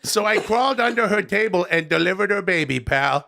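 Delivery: chummy reviewer spinning a tall tale; Adam Sandler. Acted.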